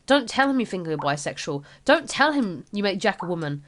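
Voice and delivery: ordinary talk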